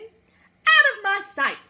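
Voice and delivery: slow, loud, high royal command; furious order. Acted. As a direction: banish him, drawn out and loud. Queen dismisses Walter.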